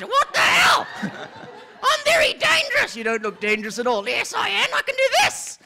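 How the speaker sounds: high, loud, offended lion; indignant lion. Acted. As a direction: protest like a proud lion. Lion insisting he's dangerous.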